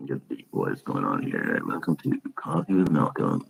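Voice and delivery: low, soft radio host; deep podcast host voice. Pretend. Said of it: "Should I talk like this all the time".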